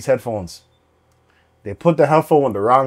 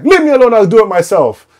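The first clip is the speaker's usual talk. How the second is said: high, loud, curt put-on voice; mock curt customer; 7 semitones higher, 6 dB louder than usual. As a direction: brush them off sharply. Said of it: "It's like".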